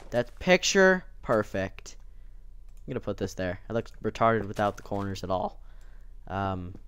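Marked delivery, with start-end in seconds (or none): none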